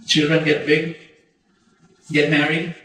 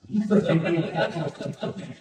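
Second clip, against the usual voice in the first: soft chuckle; 4 dB quieter than usual. Real, not acted. Laughs at his birthday wish.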